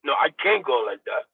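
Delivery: ordinary talk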